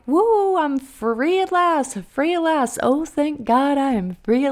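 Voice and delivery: high, loud woo then slow free at last; elated relief. Retold or imitated. Teacher recalling her last day, high and joyful. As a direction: celebrate like you just got out. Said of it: "And of course I was like".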